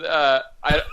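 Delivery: ordinary talk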